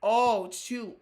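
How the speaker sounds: normal talking voice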